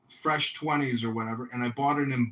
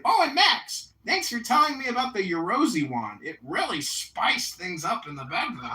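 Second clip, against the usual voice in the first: mock dad voice; 7 semitones higher than usual. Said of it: "He's like".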